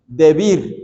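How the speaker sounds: "debir" with the accent on the I; stress on final I. Shown as a demonstration. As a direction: say "debir" as de-BIR, stressing the last syllable. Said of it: "con el acento en la I".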